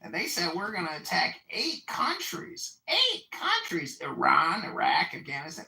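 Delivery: high-pitched, incredulous quote; animated retelling. Retold or imitated. Retelling a general's Pentagon story, animated high-pitched quote. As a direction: punch the repeated words in mock astonishment. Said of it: "and he says".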